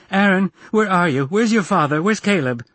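high, agitated calling out; frantic mother's high shout. A narrator's reading. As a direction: yell questions from the next room. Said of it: "Caroline shouted from the kitchen".

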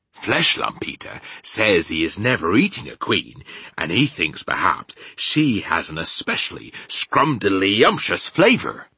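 rustic giant rising with relish; BFG, relishing. Acted. Giant reporting another giant's appetite, with relish. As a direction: build loudly to the tasty word.

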